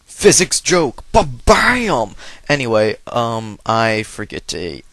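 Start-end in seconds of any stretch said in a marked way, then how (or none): 0.1-2.2 loud, high comic announcement; mock punchline fanfare. Gamer flagging his own joke to viewers, loud hammed-up shout. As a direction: shout it like a rimshot after a bad pun.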